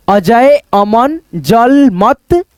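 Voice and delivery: each word drawn out very slowly; patient teacher's slow dictation; slow reading aloud. Shown as a demonstration. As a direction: read it out syllable by syllable for a child.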